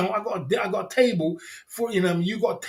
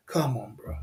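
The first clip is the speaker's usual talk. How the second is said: whisper; 6 semitones lower, 5 dB quieter than usual. Real, not acted. Exasperated at commercialized clubs.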